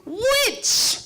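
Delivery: incredulous. Acted. Grandmother incredulous at reason.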